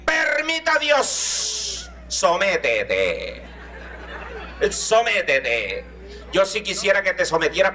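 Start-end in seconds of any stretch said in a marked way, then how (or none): 0.0-5.8 slow, drawn-out threat with laughter; mock scolding mother. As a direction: drag it out like a mother warning her son. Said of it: "mi mamá decía".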